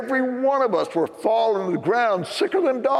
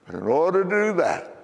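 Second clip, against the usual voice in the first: preaching; 2 semitones higher than usual. Acted. Preaching to congregation.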